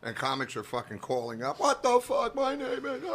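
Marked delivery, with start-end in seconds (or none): 1.6-3.0 high-pitched hammed-up outrage; mock outrage. Comics calling up about their names, in mock outrage. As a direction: squawk it high like an indignant comic.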